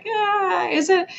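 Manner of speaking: high, skeptical drawn-out question; sarcastic. Retold or imitated. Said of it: "And I'm like".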